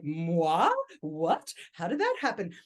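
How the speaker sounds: playful faux-innocent astonishment; a hammed-up who-me reaction; mock surprise. Getting the award call, a playful mock who-me reaction. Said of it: "I was like".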